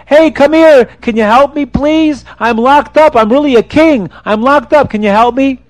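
high pleading call to a stranger; calling for help. Acted. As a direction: beg a passerby to come over. King calls to passerby.

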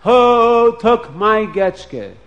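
high, slow wailing cry; mock scream. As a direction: wail it out slowly. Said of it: "I said".

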